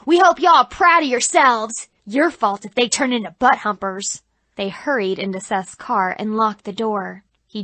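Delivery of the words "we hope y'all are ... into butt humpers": loud Southern scolding; angry mother. Retold or imitated. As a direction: spit it at the teens. Mother keeps yelling.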